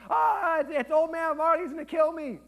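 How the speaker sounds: put-on high-pitched panicking kid; mock freak-out. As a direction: squeal it like a terrified kid. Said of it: "he freaks out".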